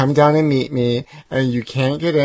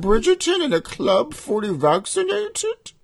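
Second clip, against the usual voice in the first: higher put-on posh accent, teasing; 9 semitones higher than usual. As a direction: tease your friend in a fake posh English accent. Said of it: "the accent that you're using".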